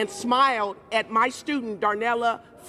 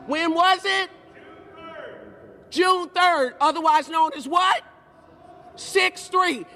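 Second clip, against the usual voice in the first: rallying call; 4 semitones higher than usual. Real, not acted. Call and response with crowd.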